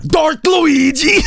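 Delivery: high, slow fanboy shout; hype shout. Pretend. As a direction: bellow it like a theater reveal.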